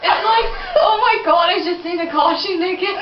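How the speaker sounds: high, loud words through giggles; laughing while talking. Real, not acted. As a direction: shriek it out laughing. Laughing describing drawing.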